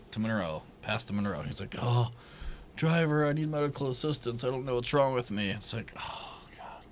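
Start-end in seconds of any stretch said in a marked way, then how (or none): 1.8-5.5 high, pleading helpless man; whiny passenger voice. Retold or imitated. Imitating passenger asking for help. 6.0-6.8 exasperated whispered groan; whispering. Retold or imitated. Retold own reaction, whispered.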